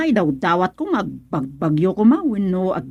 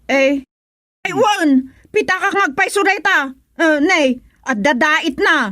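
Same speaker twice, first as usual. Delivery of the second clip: put-on shrill old woman crying out that she is being blamed; agitated old woman; 7 semitones higher than usual. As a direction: cry out like an outraged old woman. Old woman claims the wallet.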